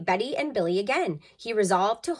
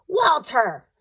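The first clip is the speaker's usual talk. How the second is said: blaming Uncle Irv; 8 semitones higher, 7 dB louder than usual. Retold or imitated. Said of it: "all he had to say was".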